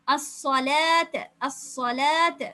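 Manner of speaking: அஸ்ஸலாத் very slow, long ா, no வா sound; slow recitation. Shown as a demonstration. Teacher chanting the word for students to repeat. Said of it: "இது வா அல்ல".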